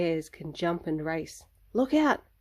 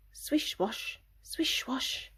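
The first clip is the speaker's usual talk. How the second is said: whispered water swish; 6 semitones higher, 7 dB quieter, slower than usual. Acted. Whale splashing in story.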